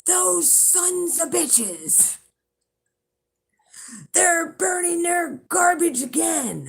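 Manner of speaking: high, slow, strained neighbor yelling; retold angry scream. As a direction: mimic the furious neighbor screaming from his car. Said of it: "He's screaming".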